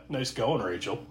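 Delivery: dad's dry comment. Retold or imitated. Host quoting the dad's jab at Rachel, low deadpan. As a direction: drop it low and quiet like a fed-up dad. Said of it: "I love the dad's comment".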